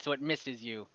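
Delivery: normal talking voice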